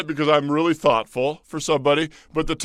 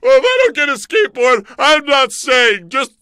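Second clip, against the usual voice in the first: loud, high, hammed-up tearful whine; fake-sob your way off the set; mock emotional; 10 semitones higher, 9 dB louder than usual. Host pretending to cry on internet TV, hammed-up wail. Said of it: "now I'm getting all emotional".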